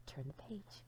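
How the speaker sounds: ordinary delivery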